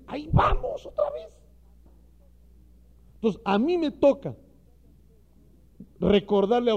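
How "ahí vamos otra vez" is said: squeaky mocking high "ahí vamos otra vez"; mock the complaint in a falsetto squeak; hammed-up whiny falsetto; exasperated mock.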